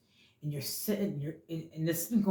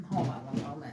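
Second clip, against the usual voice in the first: softer, muttered aside; quiet aside; 4 semitones lower, 7 dB quieter than usual. Real, not acted. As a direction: drop the voice for a quick aside. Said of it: "Oh, hold on one moment".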